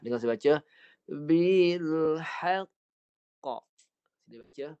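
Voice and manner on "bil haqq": Bil haqq ending in a bouncing qaf; teacher chants Bil haqq with the qaf echoing at the end; recited with qalqalah. Shown as a demonstration. Teacher showing students the bouncing qaf, recited. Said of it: "Dan pantulan qalqalah".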